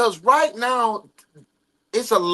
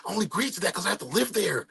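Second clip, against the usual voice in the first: quick, softer take on Cody; Cody's voice; 3 semitones lower, 12 dB quieter, much faster than usual. Retold or imitated. Reading Cody's words in his own imitated voice, quick and soft. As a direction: rattle it off lightly as Cody. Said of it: "read it in the voice of the person".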